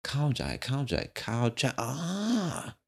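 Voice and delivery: soft high wondering murmur; wondering murmur over a Thai word; musing to himself. Retold or imitated. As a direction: savour "cow jai" softly, drifting up into a sigh. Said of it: "I'm like".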